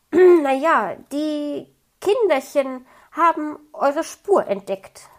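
a hint of an embarrassed magpie, slow; flustered magpie. A narrator's reading. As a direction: cover the slip, then slow right down. Frau Elster covers herself.

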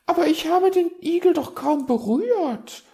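very high, loud protest; defensive magpie. A narrator's reading. Magpie defending herself against the raven, indignant. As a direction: squawk your innocence. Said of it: "verteidigte sie sich".

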